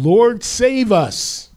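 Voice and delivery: high, drawn-out proclamation; imitated priestly proclamation, slow and high; proclaiming. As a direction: cry it out like priests at the altar.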